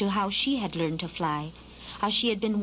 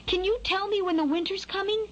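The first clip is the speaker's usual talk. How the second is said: high, anxious fledgling question; small bird needing an answer; worried; 10 semitones higher than usual. A narrator's reading. Skylark asking the worm about winter, worried. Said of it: "with a worried tone in his voice".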